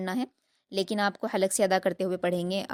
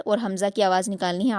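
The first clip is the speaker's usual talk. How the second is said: ordinary talk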